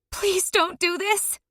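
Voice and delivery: high, slow desperate plea; pleading sob. A narrator's reading. Narrator voicing the girl begging him to stop, sobbing. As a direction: beg through a sob. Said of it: "my scream turns into a pleading sob".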